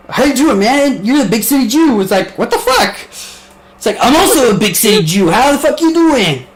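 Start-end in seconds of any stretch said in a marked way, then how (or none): 0.1-2.0 high, loud hammed-up New York greeting; big-city New Yorker. Mock big-city guy hailing Steve, hammed-up and high. 2.4-2.9 very high-pitched squawk of disbelief; mock incredulous. Reacting to the big-city greeting, hammed-up incredulous. Acted reaction in same exchange. 4.0-6.4 high, loud hammed-up reply with laughter; big-city New Yorker. One big-city guy greeting another, hammed-up and loud.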